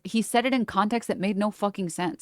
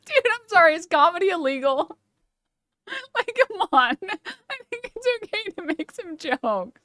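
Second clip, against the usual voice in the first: laughing; 10 semitones higher than usual. Real, not acted. Laughing through mock defense.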